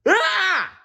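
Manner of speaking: high, loud frightened scream. Acted. Blinky screams as the dog snarls, high and loud. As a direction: shriek in fright. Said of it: "screamed Blinky".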